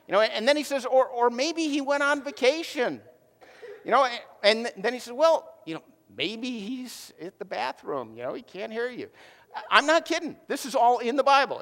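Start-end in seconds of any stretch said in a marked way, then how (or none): 0.9-3.0 higher, teasing mock advice. Elijah taunting the prophets of Baal, higher and mocking. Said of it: "Elijah starts making fun of them". 5.1-9.1 mocking. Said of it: "Elijah starts making fun of them".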